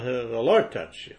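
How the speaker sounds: normal talking voice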